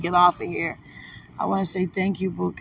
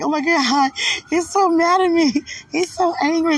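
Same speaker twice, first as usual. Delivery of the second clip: high-pitched retold panic; mock distress; 10 semitones higher, 4 dB louder than usual. Confiding in a sister in Christ, retold high-pitched distress. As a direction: replay your fretting in a high, raised voice. Retells distress over his anger.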